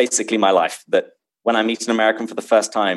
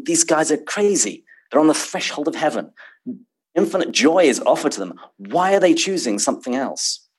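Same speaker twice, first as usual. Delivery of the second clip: higher-pitched, lightly acted disbelief; mock incredulous; 6 semitones higher than usual. A reader thinking about souls refusing heaven, mildly incredulous. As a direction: voice a baffled reader, pitch lifted. Voicing a baffled reader's thoughts.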